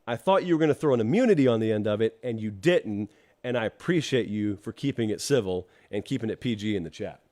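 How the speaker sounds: ordinary talk, nothing marked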